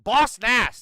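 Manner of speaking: high, loud Boss Nass impression. Streamer comparing Tom Oman to Boss Nass, loud. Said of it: "He's like".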